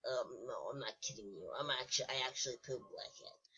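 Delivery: low, soft bunny insisting it's true. Pretend. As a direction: quietly protest as a pretend bunny. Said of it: "who's actually been doing the, uh, bunny voice".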